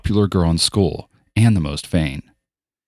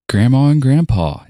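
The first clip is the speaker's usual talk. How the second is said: higher and louder greeting; pointed greeting; 7 semitones higher, 6 dB louder than usual. Acted. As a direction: call out with a dig. Ellie greets late grandparents.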